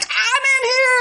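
put-on high, urgent little-girl call. Stuck girl alerts someone entering bathroom, high urgent call. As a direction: let them know you're trapped. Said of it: "So I'm like".

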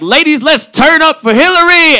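high, loud hyped-up party shout; rally the girls like it's a party; hyped party call. Retold or imitated. Calling to the ladies at the bar, hyped-up and loud.

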